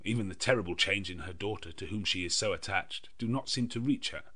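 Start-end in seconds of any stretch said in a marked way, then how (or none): none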